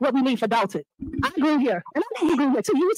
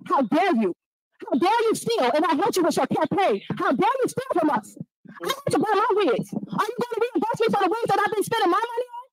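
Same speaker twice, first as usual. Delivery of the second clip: angry accusation; 7 semitones higher than usual. Real, not acted.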